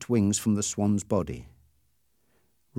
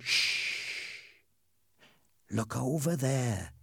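hushed, softer, slow whisper; 3 semitones higher, 7 dB quieter, much slower than usual. Acted. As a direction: hush them so the bird won't hear. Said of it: "Ernie said quietly".

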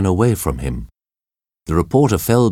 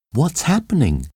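higher, anxious question; nervous stewardess asking what's wrong; 8 semitones higher than usual. A narrator's reading. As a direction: ask it on edge, a little scared.

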